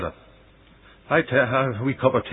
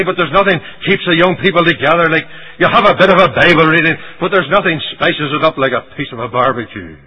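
loud, raised-pitch imitation of a protester; mock objection; 4 semitones higher, 6 dB louder than usual. Church member defending barbecues for young people, mock protest. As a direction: argue it insistently as a mock objector.

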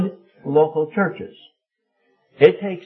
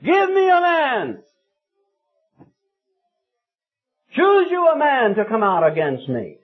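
thunderous shout; 8 semitones higher than usual. Acted. Goliath challenges Israel's army across the valley, thunderous shout. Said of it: "in his thunderous voice as he shouted".

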